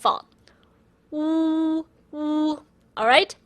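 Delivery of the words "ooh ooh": oo with the lips pushed out in a pout. Shown as a demonstration. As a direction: pout and hoot oo. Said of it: "put a pen on your top of your lip".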